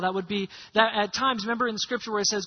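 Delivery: normal talking voice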